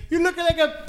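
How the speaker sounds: put-on Russian accent. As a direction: ham up the Russian girl. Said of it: "is that Miss Kim from Mad T V".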